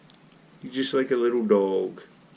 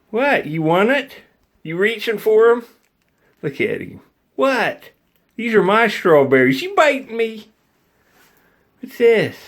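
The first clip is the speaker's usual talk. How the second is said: much higher, louder squeaky teasing; 12 semitones higher, 4 dB louder than usual. Real, not acted. Owner playing keep-away with his squirrel, squeaky baby talk.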